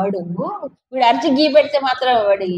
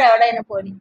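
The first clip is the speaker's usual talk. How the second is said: ordinary talk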